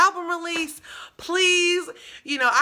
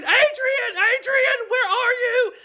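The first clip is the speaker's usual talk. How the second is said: high, loud calling out a friend's name; shouting for a friend lost in the crowd; screaming her name; 10 semitones higher, 4 dB louder, slower than usual. Retold or imitated. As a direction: yell across the crowded room, pitched way up. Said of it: "So I'm, like, screaming".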